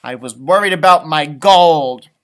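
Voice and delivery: loud grumpy leprechaun grumble; grumbled. Acted. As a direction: mutter it, covering up that he cared. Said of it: "and grumbled".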